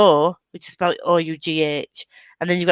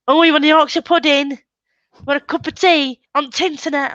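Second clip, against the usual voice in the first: higher, hammed-up Yorkshire accent; mock Yorkshire accent; 7 semitones higher, 4 dB louder than usual. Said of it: "If you're from Yorkshire".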